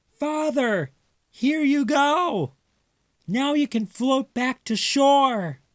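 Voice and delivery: very high squeaky mouse voices; little mice. Acted. Mice handing the rings to Father, high squeaky helpful.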